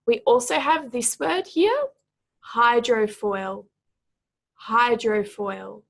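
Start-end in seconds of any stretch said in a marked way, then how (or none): 2.5-5.8 "hydrofoil" slowly, with HY and FOIL both stressed; stress on first and last syllables. Shown as a demonstration. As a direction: say "hydrofoil" twice, stretched out, weight on HY and FOIL. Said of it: "emphasis is both on the first and".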